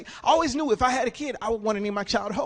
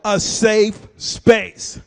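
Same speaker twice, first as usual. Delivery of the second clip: slow drawn-out repeat; 3 semitones lower, 8 dB louder, much slower than usual. Retold or imitated. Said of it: "A what?".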